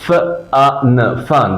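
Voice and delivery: ফান split into ফ, আ, ন, then blended; sounding out. Shown as a demonstration. Teacher drills ফান for students to repeat, sounding it out. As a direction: separate sounds with gaps, then whole ফান. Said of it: "আমার সাথে সাথে কয়েকবার বলতে হবে".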